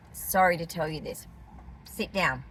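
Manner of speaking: low, soft bad-news delivery; mock-grave. Preparing viewers for bad news about cords, mock-grave.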